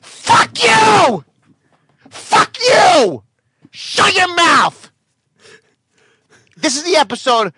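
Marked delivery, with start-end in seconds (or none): 0.0-4.9 enraged comic being heckled; furious yelling. Real, not acted. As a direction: scream down the heckler.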